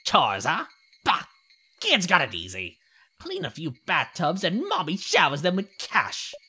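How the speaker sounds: put-on loud, scornful old shopkeeper; grumbling old lady. Shopkeeper dismissing the kid's chores, grumbling. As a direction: scoff like a cranky elder.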